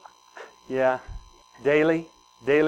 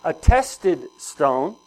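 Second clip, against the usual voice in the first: slow scripture reading; 4 dB louder, much slower than usual. Acted. Pastor reading scripture aloud slowly.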